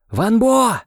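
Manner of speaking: loud, high call across the field; a high shouted call to a lost boy. A narrator's reading. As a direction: call his name into the dark. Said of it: "выкрикнул я".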